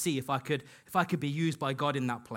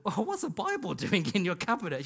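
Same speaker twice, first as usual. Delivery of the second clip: higher-pitched imitation of a puzzled colleague; raised voice-pitch, amused disbelief; mock surprise; 5 semitones higher than usual. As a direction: act out a colleague's startled question. Acts out a colleague's surprised question.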